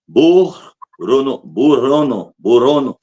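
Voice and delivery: burrono said slowly, rolled r; careful reading. Shown as a demonstration. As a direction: sound out burrono slowly, syllable by syllable. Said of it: "Lê pra mim".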